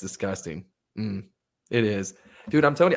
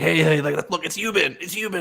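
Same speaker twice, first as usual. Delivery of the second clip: imitated excitement; 8 semitones higher, 5 dB louder than usual. Imitating friends excitedly sending pictures.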